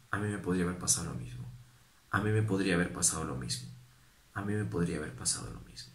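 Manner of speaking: hushed, fast self-reminder; soft humble thought. Retold or imitated. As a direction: murmur it to yourself humbly. Repeated humble reflection.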